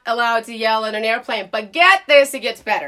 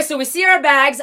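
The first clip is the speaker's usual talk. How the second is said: ordinary talk, nothing marked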